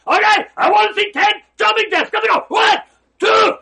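high, loud barked orders; drill sergeant shout. Retold or imitated. Big trainer shouting orders at the prisoners, loud and high. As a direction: yell the count like a boot-camp trainer. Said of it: "and he says".

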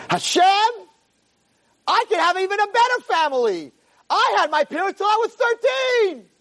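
very high, impassioned yell; crying out to heaven. Acted. Girl looks up to Shemayim, impassioned cry of complaint.

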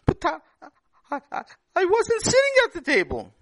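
put-on child voice explaining himself; little boy. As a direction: play a young boy insisting he did nothing wrong. Boy argues with his father.

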